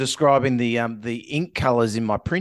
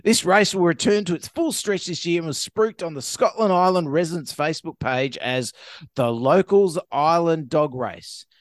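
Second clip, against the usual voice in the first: ordinary talk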